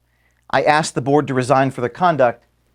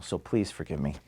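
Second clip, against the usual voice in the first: emotional, near tears; 8 semitones lower, 12 dB quieter than usual. Real, not acted. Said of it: "this is where I'm gonna get emotional".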